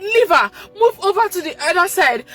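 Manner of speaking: high, loud, tearful command; crying prayer. Real, not acted. Mother praying over her baby's heart, crying. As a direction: plead through tears.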